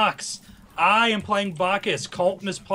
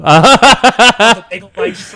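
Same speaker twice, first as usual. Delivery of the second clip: laughing loudly; 2 semitones higher, 16 dB louder, much slower than usual. Real, not acted. Laughing loud jingle start.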